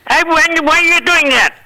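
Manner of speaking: high-pitched put-on Hervé voice, puzzled; imitating Hervé Villechaize. Hervé puzzled by Shecky's dialect, high mock voice. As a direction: squeak it up high like the little man. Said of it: "He says".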